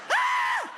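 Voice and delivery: sudden very high startled shriek; startled scream. Retold or imitated. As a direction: shriek like a boy caught in the act. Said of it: "he freaked out".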